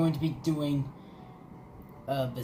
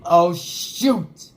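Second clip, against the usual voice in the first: sudden loud alarm; startled exclamation; 8 dB louder than usual. Real, not acted. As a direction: blurt it out startled. Sudden danger in game.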